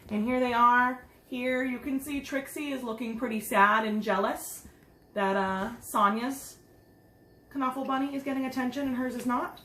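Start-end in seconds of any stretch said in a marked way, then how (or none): none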